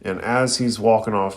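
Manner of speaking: ordinary delivery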